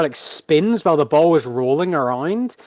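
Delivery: ordinary talk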